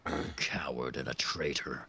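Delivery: low, whispered sneer; orc hiss. Acted. Orc muttering contempt for the traitor, whispered. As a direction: spit the insult under your breath.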